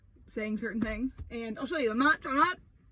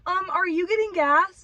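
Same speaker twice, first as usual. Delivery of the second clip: high, loud, slow snooty question; mock snotty wife voice; 8 semitones higher, 8 dB louder, slower than usual. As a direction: imitate a pushy lady asking. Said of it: "and she goes".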